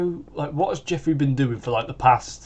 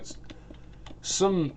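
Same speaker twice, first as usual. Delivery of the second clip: usual speaking voice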